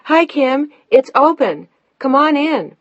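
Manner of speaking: high, loud call to the back of the house; calling out from another room. A narrator's reading. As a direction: sing out to a visitor in the next room. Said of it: "her voice rang out from the back of the house".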